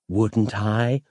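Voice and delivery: lightly teasing, questioning lilt; teasing comeback. A narrator's reading. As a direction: toss back a coy challenge. Flirtatious comeback from the librarian.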